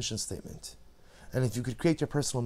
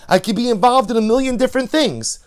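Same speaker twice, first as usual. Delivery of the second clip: much louder and higher; preaching louder; 11 semitones higher, 10 dB louder than usual. Real, not acted. Preacher warning against busyness, loud and rising. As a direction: build to full force.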